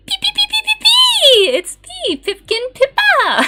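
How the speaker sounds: shrieking high catchphrase greeting to chat; Pippa. Acted. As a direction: squeal the catchphrase like a hyper cartoon bird. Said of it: "Uh, Pippa accent".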